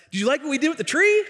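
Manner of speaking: high and fast, proud of the job; a kid proudly showing off his work; eager kid. Retold or imitated. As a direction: beam up at the neighbor, expecting praise.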